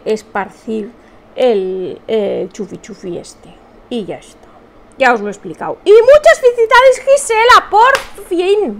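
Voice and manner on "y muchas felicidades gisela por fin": high, loud cheering; congratulating Gisela on the stream, excited; excited congratulations. Real, not acted. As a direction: cheer her on loudly. Congratulating a viewer.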